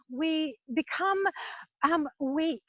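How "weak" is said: ordinary talk, nothing marked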